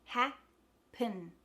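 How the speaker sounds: HAPPEN split as ha, puhn; careful HAPPEN with a schwa second syllable; syllable breakdown. Shown as a demonstration. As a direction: say HAPPEN slowly in two separate pieces. Teacher splits word into syllables.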